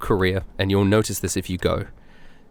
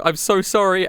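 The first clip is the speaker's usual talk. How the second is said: higher, anxious apology; apologetic; 10 semitones higher than usual. Retold or imitated. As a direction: rush to apologise, flustered. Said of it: "I'm like".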